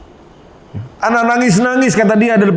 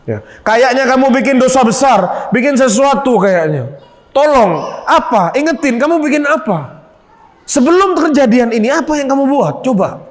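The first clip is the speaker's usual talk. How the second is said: higher, pressing voice, one shouted line; suspicious healer; 6 semitones higher than usual. Retold or imitated. Healer questioning the thug about his sin, pressing and raised.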